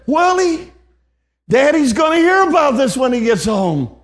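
high, loud, upset mother calling out Willie; retold threat from a hurt mother, pitched high and loud; mother's threat.

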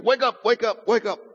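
high, quick repeated calls; imitated wake-up call. Grandfather waking his sleeping grandson, high urgent calls. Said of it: "my grandfather woke me up and said".

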